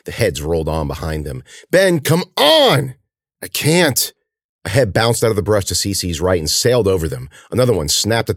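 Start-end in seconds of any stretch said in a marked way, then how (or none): 1.7-2.9 high, loud, stretched-out urging; urgent shout. Acted. Cece urges stumbling Ben on, long urgent shout. 3.4-4.1 high, strained refusal; exhausted protest. Acted. Stumbling Ben answers Cece, spent and strained. Exhausted injured boy replies.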